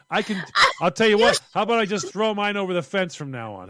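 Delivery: loud, mock-fed-up bargaining; hammed-up irate customer; indignant counter-offer. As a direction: bargain in comic outrage. Acting out irate billed customer.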